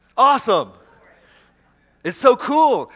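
loud, high burst of wonder; excited outburst. Real, not acted. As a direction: exclaim it in delighted awe.

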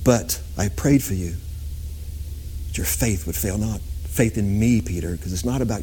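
low, gentle voice of Jesus; a lightly voiced Jesus softly warning Peter. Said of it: "And Jesus said".

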